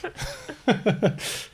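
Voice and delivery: high, breathy giggle; laugh. Real, not acted. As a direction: laugh softly at your own jibe. Joking about late puberty.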